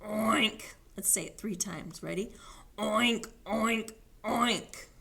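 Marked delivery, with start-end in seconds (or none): 0.0-0.7 snorty little pig noise; pig oink. Pretend. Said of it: "What does a pig say?". 2.8-4.9 three separate piggy oinks; mildly raised pig call. Pretend. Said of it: "He shouted at the howling wind".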